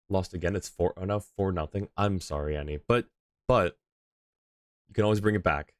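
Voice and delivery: ordinary talk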